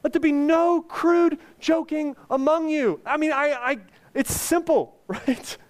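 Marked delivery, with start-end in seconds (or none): 0.0-3.0 preached verse. Acted. Verse read in raised voice.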